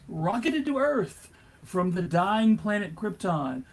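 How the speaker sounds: ordinary delivery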